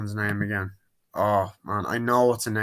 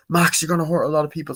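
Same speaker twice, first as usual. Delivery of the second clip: louder, high, fast warning; mock urgent warning; 6 semitones higher, 5 dB louder, faster than usual. Diana warning Max in the fight, loud and fast. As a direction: fire it off at him urgently. Hero confronting villain.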